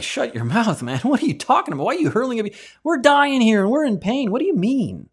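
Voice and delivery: high, loud, indignant yell at the mocking criminal; exasperated shout. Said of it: "I'd be like".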